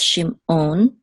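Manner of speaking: "shimon" slowly split as shim, on; separated syllables. Shown as a demonstration. Teacher sums up the name, "shimon" separated as shim-on. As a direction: stop after shim in "shimon", then say on. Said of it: "do that separation thing again".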